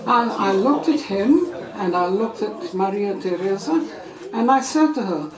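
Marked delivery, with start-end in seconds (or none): none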